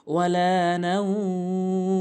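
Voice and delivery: ওয়ালা with লা held one alif, slow chant; stretched wala. Shown as a demonstration. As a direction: draw out the লা in ওয়ালা. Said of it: "লাম অবশ্যই এক আলিফ টানবেন". (লাম এক আলিফ টেনে ওয়ালা)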